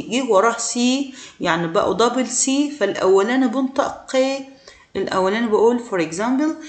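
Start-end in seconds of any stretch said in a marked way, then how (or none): none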